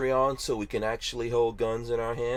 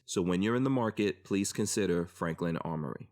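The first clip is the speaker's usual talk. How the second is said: a calm, even ad read, a touch softer; sponsor ad read; 4 semitones lower, 6 dB quieter than usual. Acted. Podcast host reading the sponsor message, smooth ad read. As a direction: read the sponsor spot like a radio ad.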